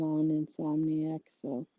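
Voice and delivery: ordinary talk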